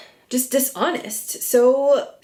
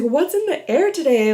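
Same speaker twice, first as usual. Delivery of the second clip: imitating Lala; 6 semitones higher than usual. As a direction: do a breezy, baffled Lala.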